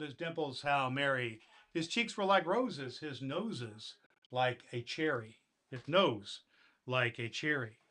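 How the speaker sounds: put-on storybook reading to children; gentle bedtime poem reading; story narration. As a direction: read the Christmas poem warmly to kids. Santa reads the poem aloud.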